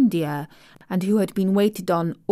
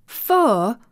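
louder, higher, astonished "For?"; astonished cry; 4 semitones higher, 6 dB louder than usual. Acted. Maid amazed the girl never saw a skipping rope, astonished cry. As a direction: cry it out in disbelief. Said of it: "cried out Martha".

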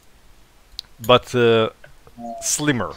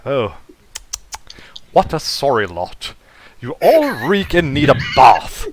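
disdainful posh English butler, rising to a louder finish; posh English accent; 3 semitones higher, 5 dB louder than usual. Acted. Robot looks over everybody else, disdainful posh English accent. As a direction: scold them in posh English. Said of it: "a posh English accent".

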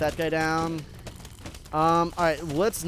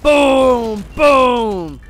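loud, high triumphant "Boom!"; excited shout; 7 semitones higher, 14 dB louder than usual. Real, not acted. Gamer yelling at cannon hit, loud high shout. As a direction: yell it as the blast lands. Cob cannons hitting zombies.

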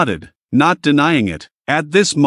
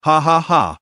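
a cold, taunting ha ha ha; short mocking villain laugh. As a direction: laugh at him as if daring him to stop you. Monica taunting before her threat.

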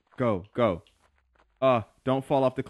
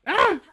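high yell; 7 dB louder than usual. Real, not acted. Charging into fight.

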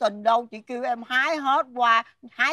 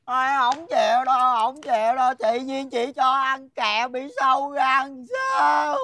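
put-on childish wailing. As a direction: bawl like a child refusing candy. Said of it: "mày nín giùm tao".

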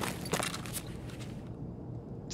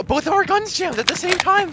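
high, incredulous outburst; excited disbelief; 3 dB louder than usual. Real, not acted. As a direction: blurt it out in disbelief. Both guns jam mid-fight.

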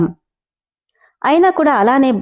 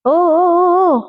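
soothing coos; 8 semitones higher, much slower than usual. Acted. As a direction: hush her tears with high coos. Hero soothes crying girl. (ఎక్కువ స్వరంలో ఓదార్పు ఓ ఓ)

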